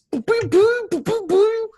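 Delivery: high, loud blaster boos; high, loud cartoon blaster fire; weapon fire sounds. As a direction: rattle off laser shots in a high voice. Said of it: "exactly kinda what it sounded like".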